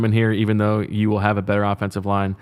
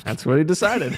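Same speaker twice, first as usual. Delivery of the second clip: laughing; 7 semitones higher than usual. Real, not acted. Laughing at the answer.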